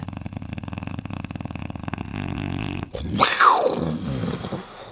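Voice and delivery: startled intake of breath; sharp gasp. Acted. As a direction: gasp in fright. Boy startled in dark.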